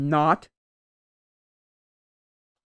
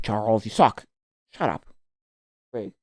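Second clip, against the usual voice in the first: usual speaking voice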